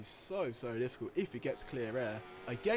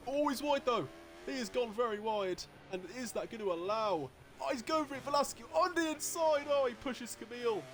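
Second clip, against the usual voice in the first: excited commentary; 10 semitones higher than usual. Real, not acted. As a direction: call the overtake like it's live on air. Overtaking battle in the race.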